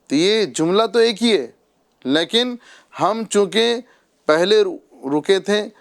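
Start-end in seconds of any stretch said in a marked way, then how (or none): none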